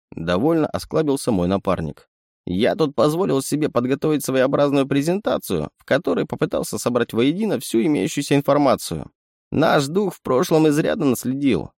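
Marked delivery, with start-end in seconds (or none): 2.4-11.7 lightly raised, teasing raccoon voice; a sly little animal sidekick; sly chittering raccoon. Acted. Raccoon presents.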